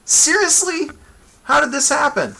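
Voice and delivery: high-pitched confused goblin. Retold or imitated. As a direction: play the goblin utterly bewildered. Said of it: "The goblin's like".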